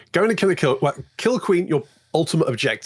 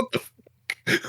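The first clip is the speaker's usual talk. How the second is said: whispered; 3 dB quieter, much slower than usual. Retold or imitated. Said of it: "I was like".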